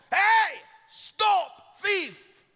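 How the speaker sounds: yelled at full power, high and stretched out; top-of-voice shout. Retold or imitated. Chasing a shoplifter, shouting at the top of his voice. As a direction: bellow it after a fleeing thief. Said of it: "I shouted at the top of my voice".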